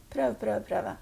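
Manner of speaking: dropped-down echo of the last word. Acted. Medium imitates the echo answering the call, echo. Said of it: "så er det ekko".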